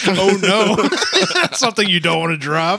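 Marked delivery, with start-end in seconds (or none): 0.1-0.9 laughing, higher oh no; mock dismay. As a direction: fake dismay through laughter.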